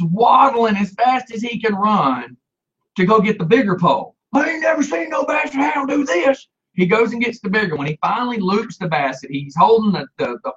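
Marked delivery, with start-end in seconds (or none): 4.3-6.5 high-pitched exclamation in a put-on voice; mock-astonished holler. Dog catcher exclaiming over a Basset hound, mock-astonished. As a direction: exclaim it high, imitating a bewildered man. Mocking retold animal control man's cry.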